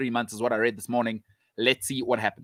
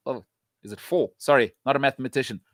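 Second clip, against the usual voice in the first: usual speaking voice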